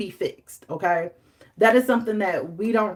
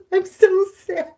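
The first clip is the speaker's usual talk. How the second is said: high, louder hammed-up wail; mock crying; 12 semitones higher, 6 dB louder than usual. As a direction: whine it like a tragic heroine. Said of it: "I'm like".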